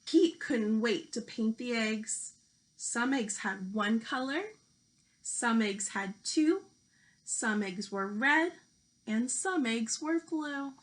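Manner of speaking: lively picture-book storytelling voice; reading to children. Acted. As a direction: read it out like a teacher at story time.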